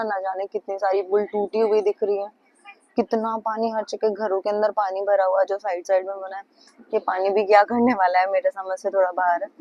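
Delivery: normal talking voice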